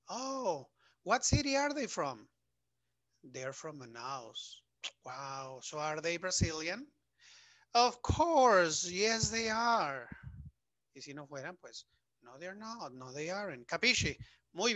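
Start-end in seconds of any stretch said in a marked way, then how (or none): 0.1-2.3 high "oh" into a lifted question; interested surprise. Acted. Teacher acting a curious listener in the dialogue, surprised. 7.7-10.1 loud, high, drawn-out acted answer; emphatic confirmation. Teacher acting the reply about being Brazilian, emphatic.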